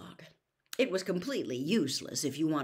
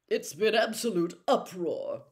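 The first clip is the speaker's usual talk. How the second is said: self-important, puffed-up and louder; important Percy; 5 semitones higher, 7 dB louder than usual. A narrator's reading. Percy lecturing his siblings, pompous and self-important. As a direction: announce it with grand self-importance. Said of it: "Percy told them importantly".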